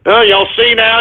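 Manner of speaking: ordinary talk, nothing marked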